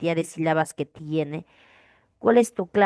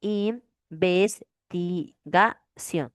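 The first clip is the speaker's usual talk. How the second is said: drawn-out syllabification; much slower than usual. Shown as a demonstration. Teacher to class, slowly splitting Investigación into syllables. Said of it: "cómo separamos".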